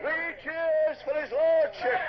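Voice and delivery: rally the men to cheer; rousing call. Acted. Officer leads crew cheer.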